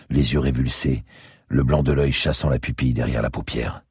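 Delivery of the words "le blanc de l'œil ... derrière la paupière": ordinary delivery, nothing marked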